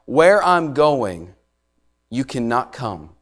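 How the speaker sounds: where I'm going drawn out slowly; slow, weighty reading of Jesus' words; slow emphatic quote. Shown as a demonstration. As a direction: lean on every word slowly. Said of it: "listen carefully".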